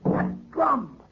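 high, hushed "That drum." with dawning fear; dread. Acted. Groom first hearing the curse drum, hushed dread. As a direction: breathe "That drum." as the terror sets in.

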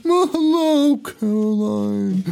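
high, loud, drawn-out cartoon villain greeting; cartoonish Toulon. Toulon greeting Caroline, slow high smug cartoon voice. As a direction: stretch out Caroline's name like a smug cartoon character. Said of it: "Toulon honestly talks like a cartoon character".

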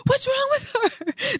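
very high, slow wailing question; mock tearful alarm. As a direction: exaggerate a panicked, crying question. Retelling own alarm at bawling.

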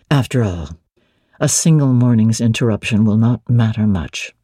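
a master speaking low and firm; Rochester's voice. Acted.

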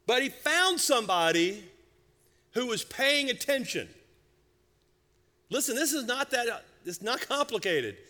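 high, pressing preacher's delivery; emphatic preaching. Real, not acted. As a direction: drive the point home to the congregation. Preaches the point of the story.

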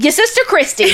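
put-on playful accent echo of "Your sister Christy"; mock accent. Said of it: "I've never even heard you say that accent".

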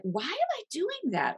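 mock dismay at your own yelling; exasperated self-question. Catching herself yelling, asking herself in high mock dismay. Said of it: "you're like".

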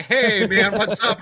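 high, loud breezy hello; casual mock greeting. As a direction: act all casual and friendly.

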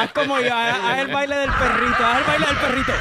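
high, loud laughing mock coaxing; mock coaxing boyfriend. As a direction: coax it in a silly loud voice. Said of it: "él le decía".

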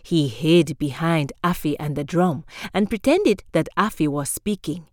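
ordinary delivery

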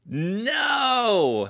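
loud, high, drawn-out disbelief; astonished cry. Real, not acted. Guest sees the surprise Vegemite, crying out astonished. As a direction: cry out in real amazement.

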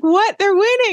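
high, loud astonished cry; shocked disbelief. Retold or imitated. Host reenacting her shock at the football game, high and loud. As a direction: react like the score just flipped. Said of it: "And I'm like".

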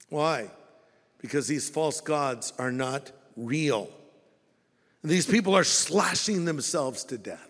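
usual speaking voice

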